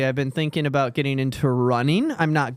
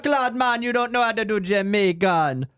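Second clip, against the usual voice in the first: high-pitched, exaggerated Jamaican accent; mock Jamaican accent; 6 semitones higher than usual. Host teasing co-host's accent plan, mock Jamaican accent. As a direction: heckle in a hammed-up Jamaican accent. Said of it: "I'm doing a Jamaican accent".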